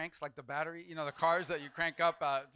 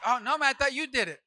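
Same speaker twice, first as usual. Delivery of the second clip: panicked pilot; 9 semitones higher, 11 dB louder than usual. Retold or imitated. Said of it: "It's like".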